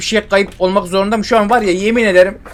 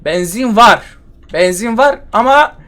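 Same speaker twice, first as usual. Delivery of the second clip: higher, louder sudden discovery; surprised; 4 semitones higher, 4 dB louder than usual. Real, not acted. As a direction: blurt it out in disbelief. Suddenly finds the gasoline.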